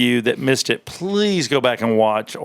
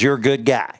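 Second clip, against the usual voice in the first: ordinary delivery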